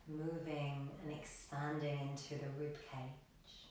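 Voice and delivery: soft, low-key yoga meditation voice with long pauses; guided meditation. Acted. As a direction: gently guide the class into stillness, leaving room to breathe. Leads a breathing meditation.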